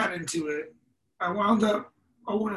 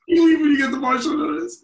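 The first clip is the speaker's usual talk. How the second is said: laughing through it, louder and higher; laughing repeat; 6 semitones higher, 9 dB louder than usual. Real, not acted. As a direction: crack up while repeating the punchline. Repeats his own line laughing.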